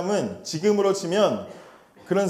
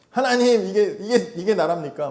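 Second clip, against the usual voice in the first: higher, louder outraged cry to God; indignant protest; 5 semitones higher, 4 dB louder than usual. Acted. Habakkuk confronting God, higher and louder outraged protest. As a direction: demand an answer, half laughing in disbelief.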